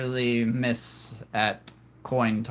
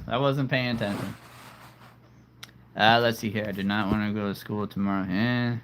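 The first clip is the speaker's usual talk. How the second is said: ordinary delivery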